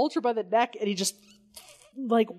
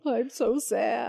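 mock sad; 4 semitones higher, slower than usual. Mocking Chief's loneliness.

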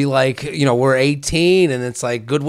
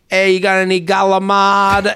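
Italian-American; 4 semitones higher than usual. Retold or imitated. Buddy's Italian in-laws at a restaurant, Italian-American accent. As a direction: order like a brash Italian-American. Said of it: "And they're like".

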